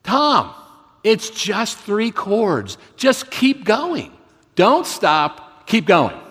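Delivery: high, loud call over the noise; shouted encouragement to a struggling bandmate; retold scream over the band. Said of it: "he screamed over the din".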